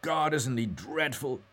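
frank, drawn-out exasperation. A narrator's reading. Mulligan agrees about Haines to Stephen, frankly. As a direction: say it frankly, high and slow. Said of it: "he said frankly".

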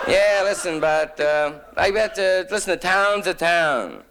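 high-pitched small-town man insisting; insistent local. Acted. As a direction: press the point high, as an imitated local. Voices local defending his town.

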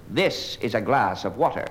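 slow, low philosophical pondering; pseudo-intellectual student. Acted. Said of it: "University of Chicago student, I will describe him for you".

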